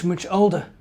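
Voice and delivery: plain reading voice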